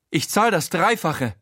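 high-pitched, urgent bid; hektisches Angebot. A narrator's reading. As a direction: blurt out a better offer as the rich lady. Frau Schowalter bietet verzweifelt mehr Geld. (hohe, drängende Frauenstimme)

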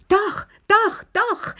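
very high, delighted squeal; excited cry. A narrator's reading. Old Lilli clapping and begging for gossip, excited cry. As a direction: squeal it like an eager child, far up in pitch. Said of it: "schrie Lilli".

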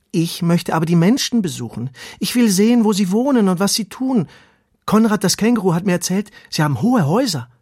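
high, loud, excited little-worm voice; eager earthworm, insisting. Acted. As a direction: burst out with your big wish.

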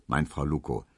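ordinary delivery, nothing marked

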